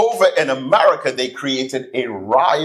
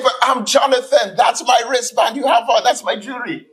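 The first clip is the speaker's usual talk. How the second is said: much higher, shouting protest; outraged shout; 11 semitones higher than usual. Retold or imitated. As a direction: yell the claim to your own name. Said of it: "He said".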